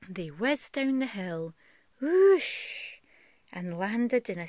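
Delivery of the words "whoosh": high loud whoosh; whoosh sound effect. Acted. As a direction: swoop up on the whoosh like a sled. Sled whizzing down the hill.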